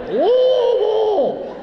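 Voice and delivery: "Louisville" high, slow and strangled, as LUH-vul; choked Louisville. Shown as a demonstration. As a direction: squeeze "Louisville" out like something stuck in your throat. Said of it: "object lodged in your throat".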